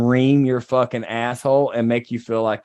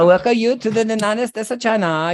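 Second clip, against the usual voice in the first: higher put-on welcoming voice; mock Chinese greeter; 4 semitones higher than usual. Imagined host welcoming people to the United States of China, higher put-on voice. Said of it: "it's gonna be like".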